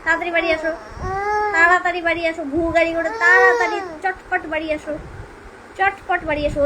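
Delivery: put-on high, loud babyish pleading; baby voice to dad. Mother voicing baby, begging father to come home. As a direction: plead like the little one. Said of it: "বাবাকে বলতো".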